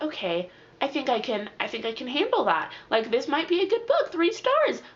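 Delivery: retold budding optimism, climbing in pitch; hopeful reaction. Retelling hopeful reaction to book's ending.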